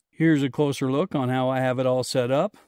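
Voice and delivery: ordinary talk, nothing marked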